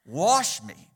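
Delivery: loud, rising plea, wash me; preacher voicing a desperate prayer for cleansing; crying out to God. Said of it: "he cried out to God".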